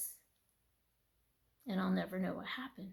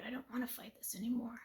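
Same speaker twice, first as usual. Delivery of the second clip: fast weary whisper; whispered prayer; 2 semitones higher, 7 dB quieter, faster than usual. Retold or imitated. Praying to the Lord before bed, fast whisper. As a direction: let the surrender slip out in a whisper. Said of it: "I said, "Lord".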